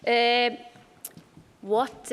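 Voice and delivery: ordinary talk, nothing marked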